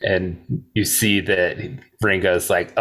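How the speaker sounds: ordinary delivery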